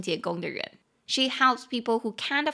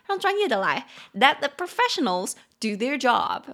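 mock bossy; 2 semitones higher, 4 dB louder than usual. Same joke in Chinese.

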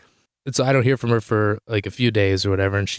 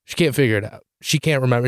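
ordinary delivery

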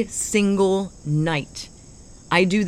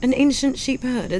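higher British put-on; Emma Thompson British; 6 semitones higher than usual. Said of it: "who you sounded".

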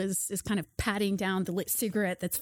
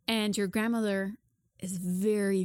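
ordinary delivery

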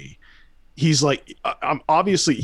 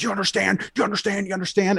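raised-pitch mock badgering; imitated demanding; 7 semitones higher, faster than usual. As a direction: mimic someone insistently demanding an answer. Mimicking the raving man's demands.

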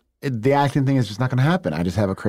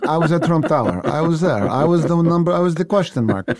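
put-on higher, heavy Russian accent, boasting; Ivan Russian accent; 6 semitones higher than usual. Actor does his Russian character Ivan bragging about Trump Tower. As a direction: brag in a thick Russian accent. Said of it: "Ivan right now".

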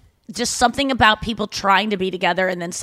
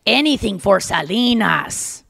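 put-on slow, drawn-out accent on "Salinas"; imitated accent from the Selena film; slower than usual. Quoting a guy from the Selena movie, imitated accent. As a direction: stretch it out in the film guy's accent. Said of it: "that's exactly how that guy sounded".

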